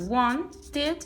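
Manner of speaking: wanted with a separate "id" syllable; wanted, -ed sounded as a full second syllable; ID ending. Shown as a demonstration. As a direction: break wanted into "wan-tid". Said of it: "pronuncio I D".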